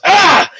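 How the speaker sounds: high yelp of pain; acted pain cry. Hera hit by arrows, high acted cry. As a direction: yelp as if struck.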